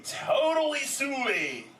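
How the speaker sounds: drawn-out, rising-high surfer slang; slow, high, stoked "totally sweet"; modelled turtle lingo. Shown as a demonstration. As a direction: stretch it out for a kid to copy. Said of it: "and say".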